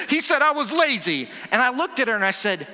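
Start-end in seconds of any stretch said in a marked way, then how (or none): 0.1-1.3 daughter's complaint. Retold or imitated. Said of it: "She said".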